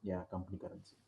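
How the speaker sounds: very low volume, hard to hear; speaking too quietly for the call; barely audible. Real, not acted. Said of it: "your voice is very low".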